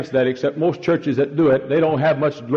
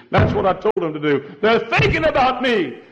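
"They're thinking about me" said much higher and louder; delighted God; 10 semitones higher, 4 dB louder than usual. Acted. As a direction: cry it out as a pleased God. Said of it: "God looked down and said".

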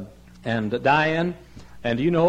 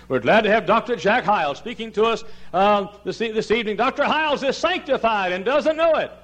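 lightly imitated high-pitched platform introduction; raised, hammed-up announcer's introduction; teasing convention speaker; 10 semitones higher, 4 dB louder than usual. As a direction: imitate a fellow poking fun from the podium. Imitates convention speaker making fun.

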